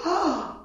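high, loud startled gasp of awe; overwhelmed gasp. Retold or imitated. Believer overwhelmed by God, gasping in awe. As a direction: let out an overwhelmed "ah". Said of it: "sometimes we just go".

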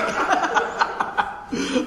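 high, sustained cackle; real rolling laughter; hearty laugh. Real, not acted. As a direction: laugh long at your own joke. Laughing at his own joke.

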